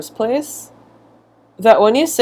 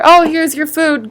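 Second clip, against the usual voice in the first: high, cheery mock waiter; 8 semitones higher than usual. Waiter to customer after two years, high cheery mock. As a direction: sing it out brightly like nothing's wrong. Restaurant hands food years late.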